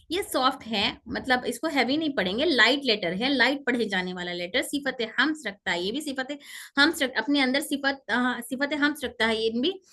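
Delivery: ordinary talk, nothing marked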